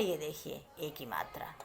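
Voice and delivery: soft hushed aside; whispered. Shown as a demonstration. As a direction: whisper the name of the sign. Teacher pointing at the sign. (फुसफुसाकर)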